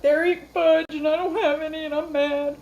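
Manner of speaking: high, loud mock tantrum; mock mad whine. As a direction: complain like a jealous kid, hammed up. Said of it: "I'm gonna be like ... I'm mad".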